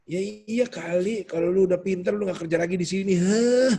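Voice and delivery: high, snide rant ending in a huff; indignant griping, then a loud scoff; mock angry venting. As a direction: act out a disgruntled social-media post. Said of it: "sosial media yang marah-marah".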